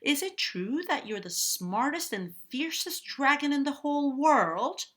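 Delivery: high, loud, eager question; big excited storybook princess challenge; princess Elizabeth. A narrator's reading. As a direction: call it out high, flattering the dragon. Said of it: "shouted Elizabeth".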